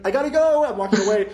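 high, loud, rushed retelling; hurried exclamation. Retold or imitated. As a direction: blurt it out while hurrying off the train. Rushing off the train.

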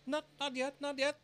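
high, loud, hurried rabbit voice; hasty rabbit. A narrator's reading. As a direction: cut in quickly as a fussy rabbit. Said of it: "the rabbit hastily interrupted".

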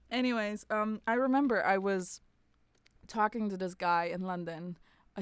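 usual speaking voice

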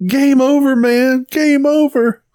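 high-pitched mock panic; panicked movie quote. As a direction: wail it like a doomed film soldier. Acted Aliens movie quote.

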